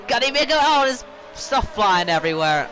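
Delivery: high, loud excited outburst; rising alarm at the chaos. Real, not acted. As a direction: shout it as the cars crash. Crash unfolding live.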